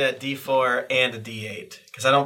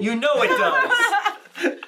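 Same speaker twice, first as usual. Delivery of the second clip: slow, drawn-out, higher, laughing through it; mock smug drawl; 13 semitones higher, much slower than usual. Player teasing the table, slow smug laughing drawl. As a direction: stretch it out to tease your friend. Teasing echo of friend's line.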